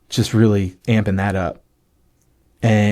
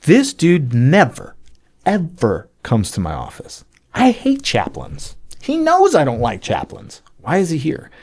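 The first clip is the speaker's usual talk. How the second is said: higher-pitched, incredulous inner rant; acted disbelief; 4 semitones higher than usual. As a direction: draw out the words in indignant bafflement. Acted baffled thoughts about an unwanted visitor.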